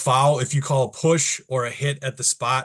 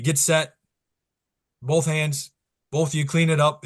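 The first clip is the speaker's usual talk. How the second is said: higher, firm one-word calls; short ref command; 5 semitones higher than usual. Shown as a demonstration. Referee warning both post players, firm command.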